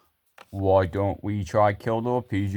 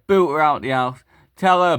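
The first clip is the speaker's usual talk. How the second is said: ordinary delivery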